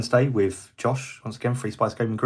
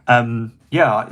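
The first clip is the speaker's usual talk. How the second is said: ordinary delivery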